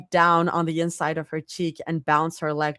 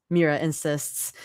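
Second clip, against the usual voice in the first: ordinary delivery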